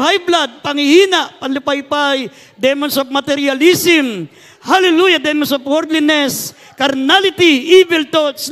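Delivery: loud, high-pitched rebuking shouts; full-force deliverance prayer. Real, not acted. Pastor casting out demons of sickness and sin, full-force deliverance prayer.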